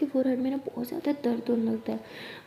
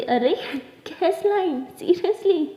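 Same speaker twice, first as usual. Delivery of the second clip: high, loud mock disbelief; playful outraged surprise; incredulous protest; 6 semitones higher, 4 dB louder, much slower than usual. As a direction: exclaim as if comically offended. Mock offence at silly pet name.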